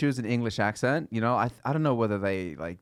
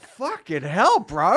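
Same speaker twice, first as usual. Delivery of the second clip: mock reply; 8 semitones higher, 6 dB louder, much slower than usual. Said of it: "I'm like".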